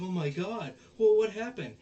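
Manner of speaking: high, loud retold gasping alarm; mock shock. Onizuka seeing her fall in two, mock shocked. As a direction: exclaim in exaggerated shock.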